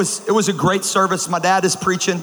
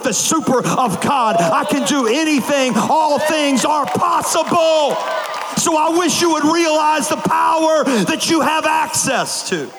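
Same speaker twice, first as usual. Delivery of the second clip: slow, shouted, drawn-out preaching climax; preaching at full force; 8 semitones higher, slower than usual. Acted. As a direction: lift the room like a revival preacher.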